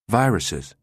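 usual speaking voice

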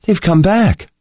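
put-on high, anxious young woman's voice; worried voice. A narrator's reading. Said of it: "came Jensen's worried voice".

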